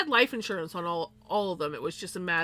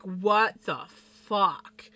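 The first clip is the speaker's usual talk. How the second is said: very slow, stretched-out mock disbelief; stunned, drawn out; much slower than usual. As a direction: draw out each word, acting dumbfounded. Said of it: "both of us were just like".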